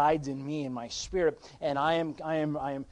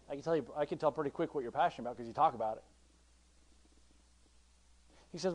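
ordinary delivery

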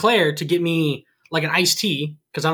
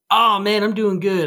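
effusive stranger; 4 semitones higher than usual. Retold or imitated. Older guy answers the small talk at Subway, mock effusive. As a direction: play up the stranger's chummy enthusiasm.

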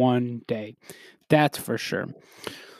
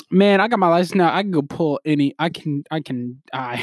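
ordinary talk, nothing marked

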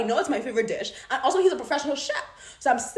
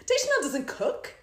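ordinary delivery

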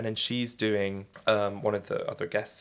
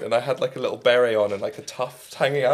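ordinary talk, nothing marked

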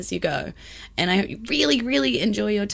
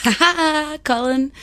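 gleeful; 7 semitones higher, 7 dB louder than usual. Real, not acted. Gleeful gotcha.

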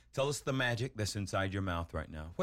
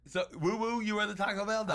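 surprised echo; 8 semitones higher than usual. Real, not acted. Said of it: "host echoing the whoop".

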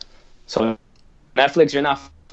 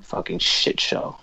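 angry mutter; 4 dB quieter, slower than usual. Real, not acted. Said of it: "I'm so angry".

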